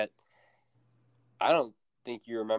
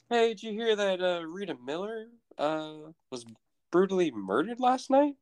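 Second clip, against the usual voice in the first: nonchalant mock sheriff; 8 semitones higher than usual. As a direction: toss it out like idle chat, pitched up. Acting out the sheriff's casual question.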